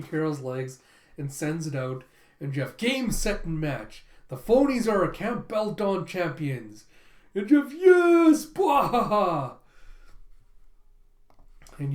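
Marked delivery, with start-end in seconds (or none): 2.4-6.8 raised, louder sports announcer call; announcer calling the win. Acted. Announcer calls the winning point. 7.3-8.5 very high, loud, slow triumphant yell; victory shout. Acted. 8.5-9.6 loud, higher, slow villain laugh; gloating cackle. Acted. Gloating after the win.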